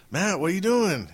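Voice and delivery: high, loud phone call greeting. Retold or imitated. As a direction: call out to a late friend. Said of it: "And I was like".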